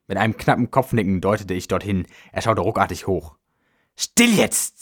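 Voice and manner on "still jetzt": high, urgent hushing command; tense, high-pitched order; sharp hiss. A narrator's reading. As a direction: snap it out sharply to silence someone. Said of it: "zischte er".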